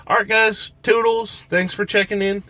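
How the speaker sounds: cheery sign-off. Real, not acted.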